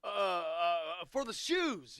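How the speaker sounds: high, loud mock thank-you; mock coach voice. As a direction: play the coach gushing thanks. Voicing the coach's thank-you text.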